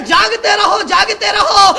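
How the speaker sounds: loud, ringing jaagte raho; loud call. Shown as a demonstration. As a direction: call out the warning to the crowd. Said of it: "चिल्ला चिल्ला के कहता हूं".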